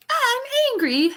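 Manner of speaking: mock-angry child voice, high-pitched; Dudley. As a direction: play a cross spoiled brat, very high. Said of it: "because Dudley's like".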